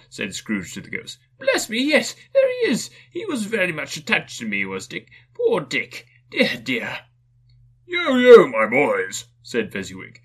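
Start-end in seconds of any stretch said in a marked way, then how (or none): 1.4-7.0 put-on high, fond old man; delighted then wistful; fond excitement. Scrooge fondly recognizing old friend. 7.8-9.2 loud jovial call; jovial Fezziwig. Acted. Fezziwig calling the apprentices.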